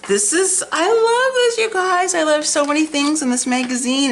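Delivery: high, loud, thrilled gushing; excited gushing. Real, not acted. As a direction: burst out with delight over the page. Gushing over magazine pages.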